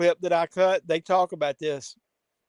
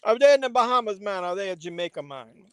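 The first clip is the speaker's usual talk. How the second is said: mock Jamaican accent; 4 semitones higher, 4 dB louder than usual. Jokey Jamaican voice again.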